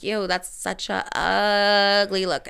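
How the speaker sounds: disgusted judgement with a long drawn-out "ugly"; slow, drawling mild disgust; mock disgust. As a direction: stretch out UGLY in disdain. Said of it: "And I was like".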